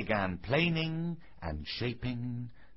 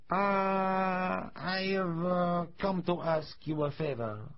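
put-on higher-pitched, hesitant old man's voice; Geppetto; 5 semitones higher, slower than usual. A narrator's reading. Jolly old visitor asks carpenter a favour, high hesitant voice. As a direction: open on a drawn-out ah, then ask haltingly.

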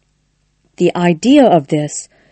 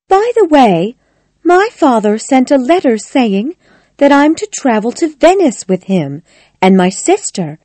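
high-pitched lady's voice; Connie, changing the subject; 7 semitones higher than usual. Acted. Connie telling Clifford about Venice, high conversational voice. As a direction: pitch it up, casually raising a new topic.

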